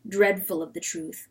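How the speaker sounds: plain reading voice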